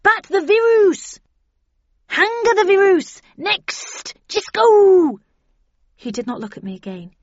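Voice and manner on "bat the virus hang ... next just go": high, loud urgent commands; desperate shouting. A narrator's reading. Snell sending the narrator away from the virus, desperate high shouts. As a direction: shout each order to get them out.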